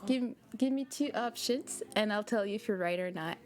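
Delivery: normal talking voice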